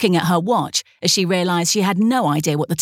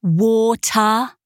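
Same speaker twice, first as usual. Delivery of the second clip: 1 slow repeat; 4 semitones higher than usual. A narrator's reading. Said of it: "she repeated slowly".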